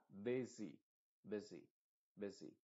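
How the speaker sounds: busy said BIH-zee; English busy. Shown as a demonstration. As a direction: say busy with a short first IH and clear final EE. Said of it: "La primera U es una E".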